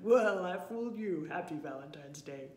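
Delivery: big bear voice, bursting loud then settling low; hearty, booming bear. A narrator's reading. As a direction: boom out the gleeful reveal. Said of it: "he boomed".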